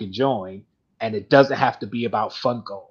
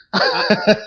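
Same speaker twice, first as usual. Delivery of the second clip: laugh; 9 semitones higher, 8 dB louder than usual. Real, not acted. Laughs at own joke.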